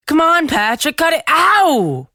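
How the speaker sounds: high, loud protest then pained cry; angry protest. Acted. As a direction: fight him off. Boy struggling with brother.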